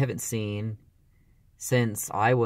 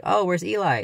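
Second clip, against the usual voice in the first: high, loud searching question; surprised call; 9 semitones higher, 4 dB louder than usual. Retold or imitated. Mom at the after party asking where he went, surprised. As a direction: ask brightly, looking around for him. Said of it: "and then she's like".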